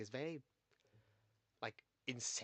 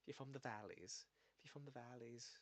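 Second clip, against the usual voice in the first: softly voiced Valleys Welsh; Welsh Valleys accent; 9 dB quieter than usual. Shown as a demonstration. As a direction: lightly put on a Welsh Valleys lilt. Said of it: "there's a difference between if you're from".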